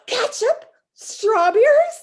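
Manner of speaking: high, slow disbelieving questions; appalled mother. Acted. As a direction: squeal each word in horror. Missus Lane appalled by the food.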